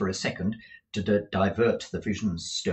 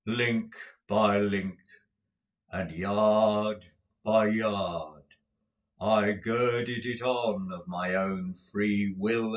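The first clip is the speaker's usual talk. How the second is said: slow, grave, measured spectral voice; solemn ghost; 2 semitones lower than usual. Acted. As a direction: lay out each link like a sentence passed. Ghost describes his chain.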